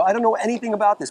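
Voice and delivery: ordinary delivery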